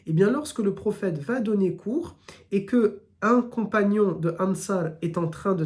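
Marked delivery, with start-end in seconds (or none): none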